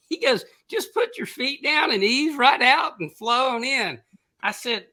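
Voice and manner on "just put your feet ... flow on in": high, slow, drawn-out instructions; calling back. Retold or imitated. Friend in chair ahead explaining the exit, calling back. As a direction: imitate a friend's carrying call.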